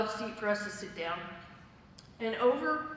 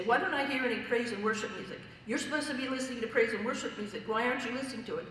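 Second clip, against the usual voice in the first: rapid-fire imitation of a nagging friend; quick, insistent pestering; 2 semitones higher, faster than usual. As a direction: rattle off the scolding without letting up. Friend nagging her in retold calls.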